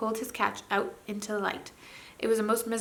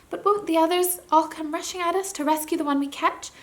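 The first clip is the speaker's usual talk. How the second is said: put-on higher, a girl trying to sound braver than she feels; not so steady; 8 semitones higher, 4 dB louder than usual. Jill asking the prince about rescuers, unsteady and higher. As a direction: keep the fear just under the surface. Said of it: "in a voice not so steady as she tried to make it".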